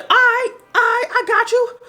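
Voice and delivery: put-on high, loud kid voice; snitching kid. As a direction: play the eager tattletale. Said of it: "He's like".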